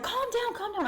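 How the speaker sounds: very high-pitched, insistent plea; urgent soothing, retold. Girl to freaking-out boyfriend, high insistent retold plea. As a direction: shoot the pitch way up to settle someone. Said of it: "I was like".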